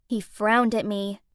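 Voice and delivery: usual speaking voice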